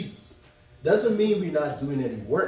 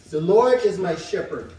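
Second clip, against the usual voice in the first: louder, solemn proclamation of the verse; solemn scripture read; 3 semitones higher, 6 dB louder than usual. Acted. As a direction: declaim the opening line to the church. Reads Psalm aloud.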